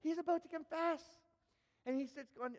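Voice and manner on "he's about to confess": high, mock-horrified glee; scandalized gossip. Onlooker awaits a public confession, scandalized. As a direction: relish the awful news, pitched up high. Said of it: "the paparazzi heart of me".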